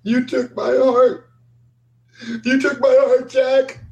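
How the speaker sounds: high, wailing ghostly accusation; hysterical crying. Acted. Game master as Susie's apparition accusing Jack, hysterical crying. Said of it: "she's crying hysterically".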